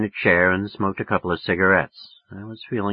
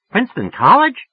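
high, louder questioning; dawning interest; 13 semitones higher, 6 dB louder than usual. A narrator's reading. Woman recognizing the college, suddenly interested. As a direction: perk up and check, pitched high.

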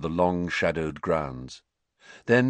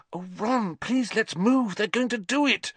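higher, frightened breathy plea; breathed pleading; 13 semitones higher than usual. A narrator's reading. Hermione begging Ron to flee, frightened and breathy. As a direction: beg him to move, barely breathing. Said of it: "Hermione breathed".

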